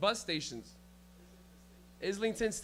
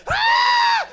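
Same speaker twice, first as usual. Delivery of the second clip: piercing mock scream; high comic scream; loud high shriek; 16 dB louder than usual. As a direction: shriek suddenly at the top of your range. Acting out mom showing her feet.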